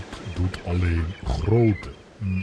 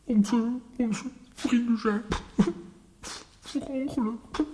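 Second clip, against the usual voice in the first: grieving lament. Acted. Friends reported dead in crash.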